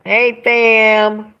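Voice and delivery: usual speaking voice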